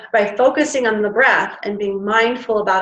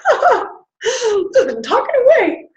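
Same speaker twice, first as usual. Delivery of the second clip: laughing at herself; 11 semitones higher, slower than usual. Real, not acted. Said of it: "laughing at herself".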